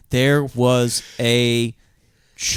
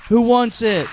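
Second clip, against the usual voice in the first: mock showman; 10 semitones higher, 4 dB louder, slower than usual. Mock offering prop to crowd.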